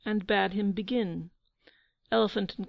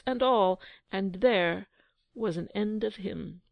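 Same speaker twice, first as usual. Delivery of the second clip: ordinary delivery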